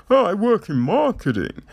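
"Oh, I work in marketing" tossed off high and fast in a smug voice; a hint of a preening know-it-all; mock boast. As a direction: parody a self-important marketer, lightly. Mocking film characters' boast.

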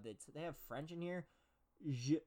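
ordinary talk

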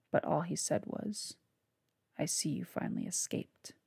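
measured, hushed reading of a dark tale; dark story narration. A narrator's reading. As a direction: read it like a gothic audiobook. Author reads his novel aloud.

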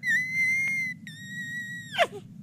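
long, very high excited squeal; thin sustained fangirl squeal; high-pitched squeal. Retold or imitated. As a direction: squeal wordlessly, held high. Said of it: "so I'm just like".

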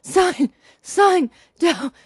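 loud, high, hammed-up gasps between words; imitating gasping. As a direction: choke out each word like a winded dad. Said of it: "I'm over here gasping".

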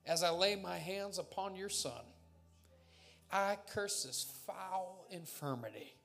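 loud commanding healing prayer, preached; laying a curse. Acted. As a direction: cast out sickness with full force. Prayer for healing, rebuking illness.

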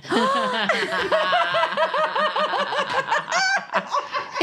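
loud, high burst of laughter; big laugh. Real, not acted. Mom laughs at her own kid's punchline. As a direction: crack up at the punchline.

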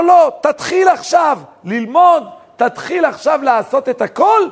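high, forceful call to begin; retold urgent exhortation. Preacher voices urgent answer to despair.

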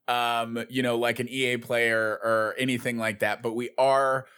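ordinary talk, nothing marked

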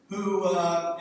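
ordinary talk

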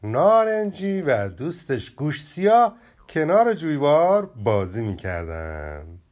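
lively children's story reading, characters lightly voiced; expressive storybook narration with playful character voices. A narrator's reading. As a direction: tell it like a bedtime tale for kids. Reads the story aloud.

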